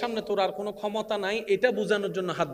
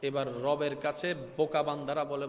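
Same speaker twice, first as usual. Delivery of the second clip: ordinary talk, nothing marked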